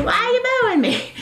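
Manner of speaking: hammed-up Sylvia, thick New York accent. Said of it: "thick New York accent".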